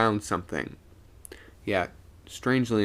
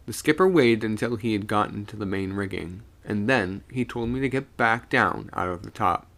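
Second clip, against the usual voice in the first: usual speaking voice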